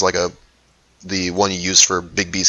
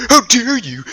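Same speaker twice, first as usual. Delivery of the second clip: offended outrage; 14 semitones higher than usual. Acted. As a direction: cry out, deeply insulted. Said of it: "like, really upset".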